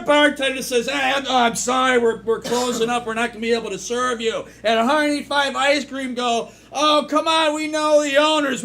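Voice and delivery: high, loud, over-excited joke telling; hammed-up yelling comic; shouted joke delivery. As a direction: belt out the joke to the room. Player belts out improvised joke.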